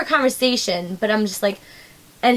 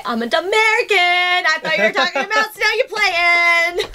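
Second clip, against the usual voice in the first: slow mock explaining; 6 semitones higher than usual. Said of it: "how do I say to this guy".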